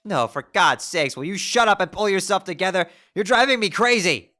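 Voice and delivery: loud exasperated rant; Karkat-style yelling. Acted. Jade yelling at her moping self like Karkat, loud rant. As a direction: yell at her to pull herself together. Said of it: "Jade has turned into Karkat".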